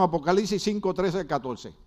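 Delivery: ordinary talk, nothing marked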